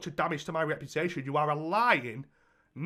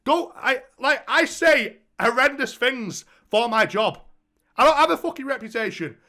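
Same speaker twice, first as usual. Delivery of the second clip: heated rant; 7 semitones higher, 7 dB louder than usual. Retold or imitated. Ranting back at ex in retelling.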